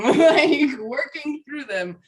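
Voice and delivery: ordinary delivery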